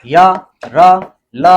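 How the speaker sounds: slow letter recitation. Shown as a demonstration. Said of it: "ये घोष वर्ण हो गया".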